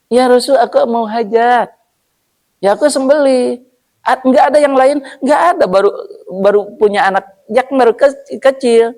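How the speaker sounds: high, insistent owner explaining his need; insistent camel owner. Acted. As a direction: argue your case, high and pleading. (pemilik unta bersikeras dengan suara tinggi)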